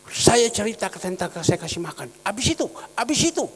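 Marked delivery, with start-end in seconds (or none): 2.2-3.4 quick, high eager prompting; very enthusiastic. Retold or imitated. Eager listener urging the pastor's story on, excited and quick. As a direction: hurry the listener on, eager for more. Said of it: "wih, antusias sekali".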